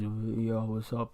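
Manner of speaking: soft mumble through a closed mouth. Shown as a demonstration. Showing friends how he talked after surgery, mouth closed. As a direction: talk without opening your mouth. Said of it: "with your mouth closed".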